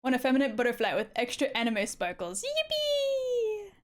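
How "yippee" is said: high squealed yippee; excited cheer. Acted. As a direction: squeal it with glee. Cheering at anime sparkles.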